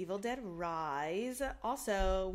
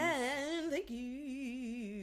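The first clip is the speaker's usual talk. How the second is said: slow drawn-out; 5 semitones higher, much slower than usual. Real, not acted. Drawn-out playful thanks.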